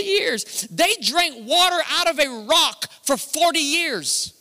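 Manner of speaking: high, loud, ringing preacher cry; preaching at full force. Real, not acted. Preacher to the congregation, soaring loud and high. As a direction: lift the crowd with a soaring holler about miracles.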